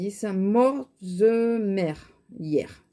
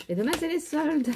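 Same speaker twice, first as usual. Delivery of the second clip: much higher, sing-song announcement; excited; 9 semitones higher than usual. Real, not acted. Vlogger telling viewers of tomorrow's sales, excited and high. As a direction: toss it out high and eager, like big news.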